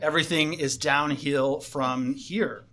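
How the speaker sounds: normal talking voice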